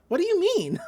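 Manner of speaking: high-pitched, mock-baffled question. She questions his odd logic, mock-baffled. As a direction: imitate her thrown by his logic. Acts out her baffled reaction.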